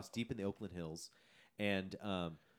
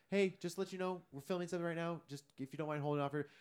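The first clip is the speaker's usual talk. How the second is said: high, courteous customer-service voice; gently flag down a passerby; retold apologetic request, pitched up; polite heads-up; 8 semitones higher than usual. Politely warning a passing jogger.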